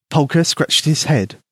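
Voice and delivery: plain reading voice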